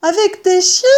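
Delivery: put-on high, loud child fox voice; frightened fox cub. Fox cub asks mother about the diggers, frightened child voice. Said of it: "écarquillés de terreur".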